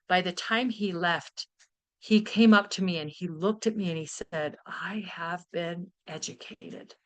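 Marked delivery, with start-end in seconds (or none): 4.7-6.9 soft humble admission. Retold or imitated.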